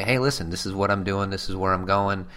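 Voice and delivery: ordinary talk, nothing marked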